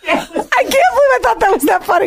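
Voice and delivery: high, helpless laughter through the words; can barely get it out for laughing; laughing hard. Real, not acted. Host cracking up at a co-host's joke, laughing hard.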